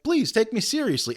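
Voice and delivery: high mock begging; same high plea, played for comedy. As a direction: imitate a pleading appeal. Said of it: "like, begging".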